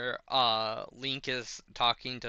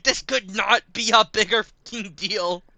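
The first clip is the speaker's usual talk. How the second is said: higher and louder exclamation; freaked-out Purah; 10 semitones higher, 13 dB louder than usual. Retold or imitated. Streamer repeats Purah's freak-out, loud high disbelief. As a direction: shout it in disbelief. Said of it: "She's like".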